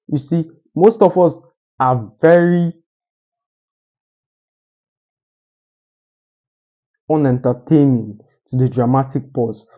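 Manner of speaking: long held pause before "unentertaining"; dramatic pause. Shown as a demonstration. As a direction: stop dead after "very", then land "unentertaining". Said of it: "You see what I just did there?".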